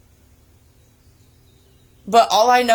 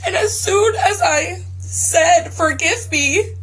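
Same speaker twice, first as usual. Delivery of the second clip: real tears breaking through; crying; 5 semitones higher than usual. Real, not acted. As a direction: let the tears break in as you say it. Reliving the moment of forgiveness.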